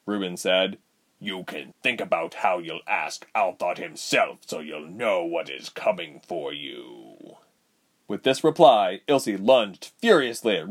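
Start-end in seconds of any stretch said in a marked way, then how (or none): 1.2-7.4 menacing villain. Acted. Voices villain Reuben in story.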